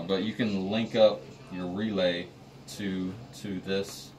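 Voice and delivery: ordinary talk, nothing marked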